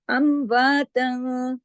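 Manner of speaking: slow, drawn-out Quran recitation; chanting the verse as a teacher's model; tajweed recitation. Shown as a demonstration. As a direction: recite it slowly with careful tajweed. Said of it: "इजहार नहीं किया".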